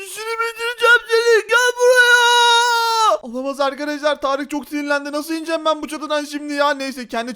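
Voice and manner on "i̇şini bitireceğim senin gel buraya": loud, high furious chase yell; hammed-up raging shout; angry threat. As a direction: bellow it, stretching the final word.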